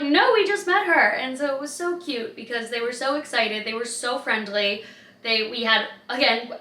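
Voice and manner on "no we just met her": high and loud, cheerful; bright girly reply. Retold or imitated. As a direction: chirp it back like new friends.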